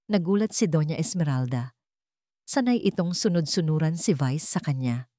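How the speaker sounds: usual speaking voice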